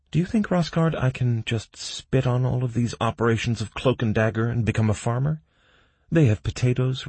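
put-upon professional holding forth; consultant, theatrically aggrieved. Acted.

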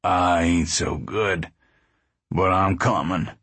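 weak and sad. A narrator's reading. Uncle John unwell but coming along, weak. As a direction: drag the words out, low and weary. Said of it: "sick and weak and sad he looked".

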